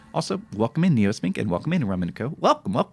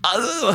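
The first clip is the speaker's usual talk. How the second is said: high groan; 3 dB louder than usual. Real, not acted. Frustrated groan.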